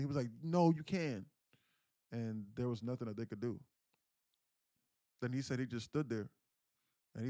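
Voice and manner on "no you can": loud, higher retort, acted out; imitating a frantic contradiction; desperate insistence. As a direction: refuse to accept it, raised voice. Father refusing the medics' surrender.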